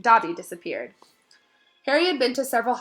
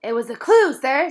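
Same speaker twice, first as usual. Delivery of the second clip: higher, louder elf voice; elf, matter of fact; 6 semitones higher, 9 dB louder, slower than usual. A narrator's reading. Dobby explains to Harry as if obvious. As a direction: explain the obvious, eyes wide. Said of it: "as though this was obvious".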